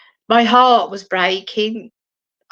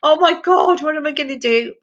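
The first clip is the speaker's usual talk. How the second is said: panicked; 7 semitones higher, faster than usual. Retold or imitated. Telling the dream's pressure, she voices her own higher panic. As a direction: flap about in alarm. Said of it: "And I was like".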